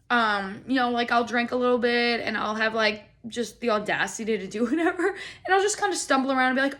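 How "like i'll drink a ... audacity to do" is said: ordinary talk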